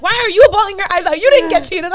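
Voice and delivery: loud, high, fast re-enacted outburst; outraged yell. Cheated-on woman to his bawling mother, outraged yell. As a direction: yell it in hammed-up indignation.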